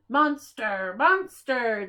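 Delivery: loud chanted cheer. A narrator's reading. Rascals cheering the monster down the mountain, loud chant. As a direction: cheer after him as he runs. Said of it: "they cheered after him".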